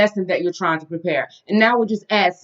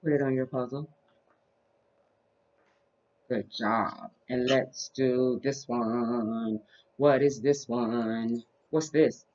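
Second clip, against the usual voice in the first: put-on reading to a toddler; 5 semitones lower, 8 dB quieter, slower than usual. Teaching toddler shapes.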